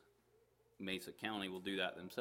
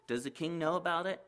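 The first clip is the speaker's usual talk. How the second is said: indignant question; 9 semitones higher, 6 dB louder, faster than usual. Acted. Friend asks indignantly.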